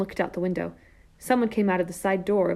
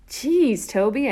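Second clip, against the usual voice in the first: exasperated; 5 semitones higher, 6 dB louder, much slower than usual. A narrator's reading. Disgusted at brother's breath.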